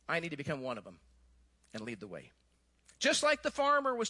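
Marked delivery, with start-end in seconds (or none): 0.1-2.3 much softer and lower, fading to a hush; tender voice of God. Acted. God resolving to become human, softly and tenderly. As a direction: say it gently, like a quiet promise. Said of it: "God said".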